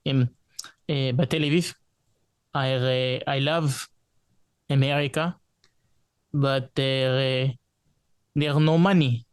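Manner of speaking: put-on heavy Israeli English accent; broken English, thick Israeli accent. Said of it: "I could do Israeli accent".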